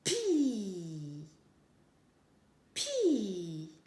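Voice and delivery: "pi" with a very long i gliding down; syllable sounded out. Shown as a demonstration. Teacher showing what the joined letters make, drawn-out syllable. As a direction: stretch out the i of "pi", letting it fall. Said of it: "ça fait".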